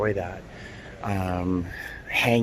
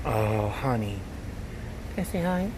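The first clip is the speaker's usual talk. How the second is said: cooing to a cat; 2 semitones higher, 9 dB quieter than usual. Real, not acted. Asking a shy kitten to say hi, soft cooing. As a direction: gently ask a kitten for a hello.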